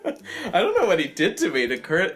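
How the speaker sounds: ordinary delivery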